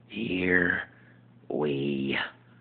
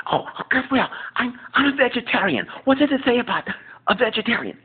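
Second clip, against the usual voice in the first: higher, louder flamboyant sitcom voice; Jack impression; 6 dB louder than usual. Jack telling Will about his crisis, high and loud. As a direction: burst in with drama. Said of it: "impersonation of ... Just Jack".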